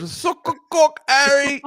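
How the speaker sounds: high, hammed-up British accent; silly British accent. Mock author urges Harry on, silly British accent. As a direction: egg him on in broad mock-English. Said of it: "you're almost slipping out of the accent".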